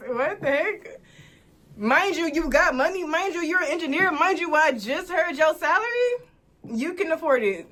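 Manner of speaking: indignant rant. Real, not acted. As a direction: pile on the grievances. Venting about date paying.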